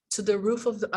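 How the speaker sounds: normal talking voice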